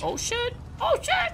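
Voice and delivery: high startled cry; shocked yelp. Real, not acted. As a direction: yelp in alarm. Explosion reaction.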